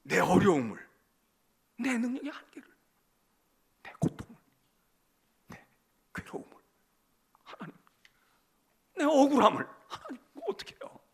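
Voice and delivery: halting prayer with bursts of loud lament; acted plea, broken by long pauses; pouring out anguish. Believer pouring out hardship and injustice to God, anguished. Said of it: "그러고 토로하는 거라".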